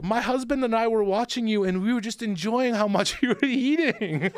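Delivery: put-on a light German accent on the wife's words. The German wife praising his eating, in a German accent. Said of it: "Do your German accent".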